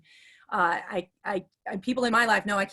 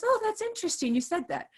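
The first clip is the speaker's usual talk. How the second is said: high-pitched, intrigued imitation; imitating a pleasantly intrigued man; positive man's reply; 11 semitones higher than usual. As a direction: pitch the "Oh" way up, then ease down. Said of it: "and then he goes".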